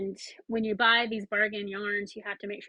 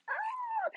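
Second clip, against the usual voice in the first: high delighted squeal; excited squeal; 5 dB quieter than usual. Real, not acted. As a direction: squeal at the sight of the yarn. Squeal at the yarn reveal.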